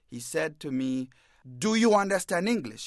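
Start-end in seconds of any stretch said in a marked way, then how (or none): 1.6-2.9 raised, higher mock shout at him; imitating the cop barking a demand; retold shout. Cop yelling at a stopped man, a higher, louder mock shout. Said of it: "He's shouting".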